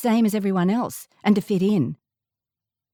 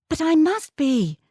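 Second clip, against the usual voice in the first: put-on insisting child; 8 semitones higher than usual. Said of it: "I insisted".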